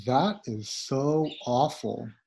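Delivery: slow and clear for learners. Shown as a demonstration. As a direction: read it out slowly, word by word. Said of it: "slow teaching take".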